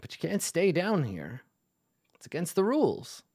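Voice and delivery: lightly voiced rule-bound dwarf; old dwarf. Dwarf answering the player.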